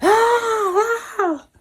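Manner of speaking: high shrieking "ah, ah"; girls screaming. Acted. Girls seeing the shadow again, high scream. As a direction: shriek like a scared child. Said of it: "comenzaron a gritar otra vez".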